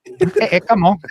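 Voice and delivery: loud, indignant objection; loud protest. Real, not acted. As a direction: shout it down in protest. Protesting lost points.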